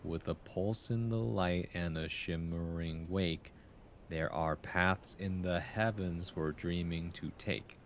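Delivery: slow, measured poem recitation; poem reading. Acted. Streamer reading a viewer's poem aloud, slow recital. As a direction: read each line like verse, unhurried.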